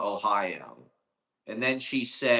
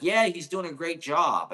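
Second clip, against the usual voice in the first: a hint of the sweet nurse, "Yeah" lifted higher and louder; quoting the nurse; 6 semitones higher, 4 dB louder than usual. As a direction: lightly voice the nurse saying "Yeah, he's doing a great job" as cheerful praise. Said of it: "She's like".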